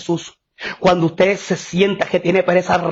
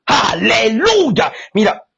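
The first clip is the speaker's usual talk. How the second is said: ordinary talk, nothing marked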